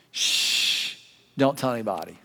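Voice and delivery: hushed secret. Retold or imitated. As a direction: hush them like a secret. Jesus hushing the disciples.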